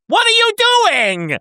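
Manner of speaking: sudden very high-pitched mock shriek; outraged screech. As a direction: blow up in a comically high, outraged squawk. Acts out Uriel suddenly flipping mid-apology.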